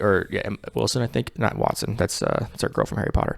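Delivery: unvoiced, soft correction; whispered aside. Real, not acted. Speaker whispering a correction to friends. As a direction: whisper it as a side note.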